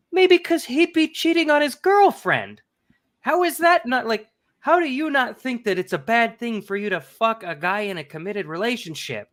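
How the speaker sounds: mock her obliviousness with a sneering lilt; sarcastic mockery. Mocking author's obvious answer.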